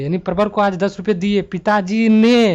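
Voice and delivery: forceful emphasis landing on पिताजी ने at the end; stress on पिताजी ने. Shown as a demonstration. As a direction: hammer the closing पिताजी ने. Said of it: "यहाँ पे पिताजी ने".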